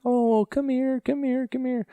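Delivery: put-on very high sing-song baby talk to a dog; cooing at a dog. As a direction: coax the dog in a squeaky pet voice. Strangers calling scared dog.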